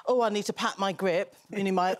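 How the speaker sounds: ordinary delivery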